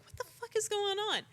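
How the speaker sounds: high, exasperated swear; baffled outburst. Retold or imitated. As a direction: yelp it up high in disbelief. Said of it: "I'm like".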